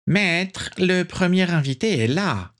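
high, fawning servant voice; obsequious. Acted. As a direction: simper like a groveling butler. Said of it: "dit-il avec obséquiosité".

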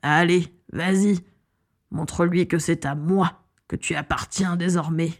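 lightly voiced villain urging on a beast; sinister, commanding encouragement; possessive villain. A narrator's reading. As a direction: goad the dragon with controlled menace. Villain urges the dragon on.

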